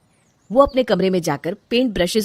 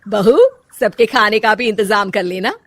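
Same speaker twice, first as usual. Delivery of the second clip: louder, commanding mother-in-law calling out; bossy mother-in-law; 6 dB louder than usual. Acted. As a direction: order the daughter-in-law around. Mother-in-law gives orders. (रौबदार सास की आवाज़)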